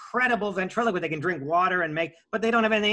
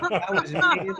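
laughing; 11 semitones higher, 4 dB louder, slower than usual. Real, not acted. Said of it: "I don't know who that was".

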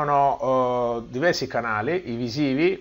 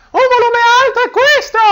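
much higher and louder; high pitch, loud; 11 dB louder than usual. Shown as a demonstration. Teacher shows high tone at high volume, loud and high. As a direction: pitch up and push the volume hard.

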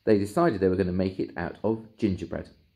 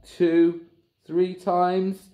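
usual speaking voice

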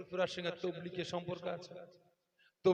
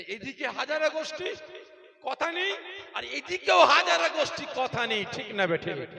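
loud, high fiery sermon; rally the crowd, listing sects; full-force preaching; 12 semitones higher, 16 dB louder than usual. Real, not acted. Preacher rallying the congregation against division, full-force preaching.

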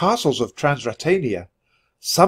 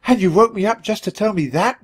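squeaking; 8 semitones higher than usual. Acted. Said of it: "my sister squeaked".

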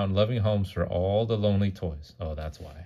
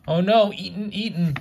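high, loud mock alarm; alarmed exclamation; 12 semitones higher, 6 dB louder than usual. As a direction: gasp out the peril for the child. Parent reacts to Clarice's peril.

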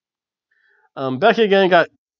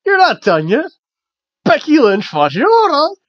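high, louder mock scolding; Asuka; 9 semitones higher, 3 dB louder than usual. Wrestler confronting a fleeing rival, high mock scolding. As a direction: imitate an indignant wrestler, pitched well up.

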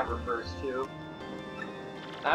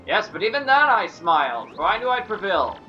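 affected fluting fop. Acted. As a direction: brag like a vain dandy. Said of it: "Flavio's voice".

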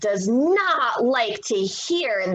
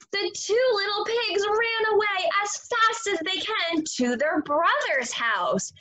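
plain reading voice

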